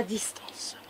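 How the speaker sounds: breathy whisper, no voice. Acted. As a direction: whisper it to yourself. Repeats the phrase, then whispers.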